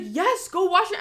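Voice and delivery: high, loud mock-panicked order; retold fake urgency. Big sister pranking her sister, urgently alarmed. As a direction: feign an emergency to fool her.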